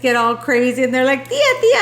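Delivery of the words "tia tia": high, raised call of a name, mimicking a kid; imitated child calling. Little girl at the door calling out, high imitated call.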